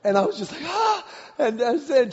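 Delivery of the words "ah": high-pitched startled ah; imitated alarmed gasp. Husband spotting wife in the current, high alarmed gasp.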